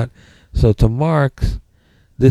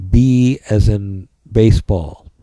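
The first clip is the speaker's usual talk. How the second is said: slow spelling out; much slower than usual. Shown as a demonstration. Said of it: "this is spelled".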